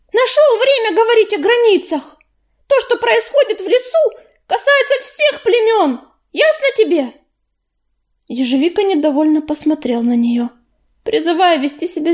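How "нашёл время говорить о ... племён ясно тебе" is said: high-pitched, enraged outburst; furious hiss. A narrator's reading. Cat rounds on Коршун over borders, in a high furious hiss. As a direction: let fury push the voice way up. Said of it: "в бешенстве посмотрела на Коршуна и прошипела".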